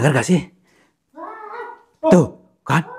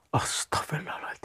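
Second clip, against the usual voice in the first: whisper; 15 dB quieter than usual. Real, not acted. Frightened prayer under breath.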